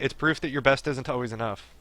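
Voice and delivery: usual speaking voice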